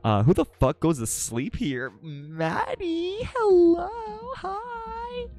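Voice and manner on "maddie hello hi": very high, stretched-out squeal; squealed greeting. Real, not acted. Streamer spotting a friend in chat, high squeal. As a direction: draw each word out way up high.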